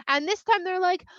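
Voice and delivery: ordinary delivery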